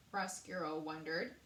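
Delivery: usual speaking voice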